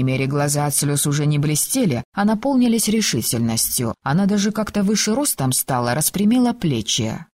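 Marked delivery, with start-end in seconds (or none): none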